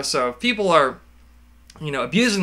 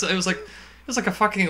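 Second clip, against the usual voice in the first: ordinary talk, nothing marked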